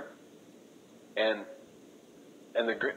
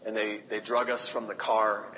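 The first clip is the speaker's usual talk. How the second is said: ordinary talk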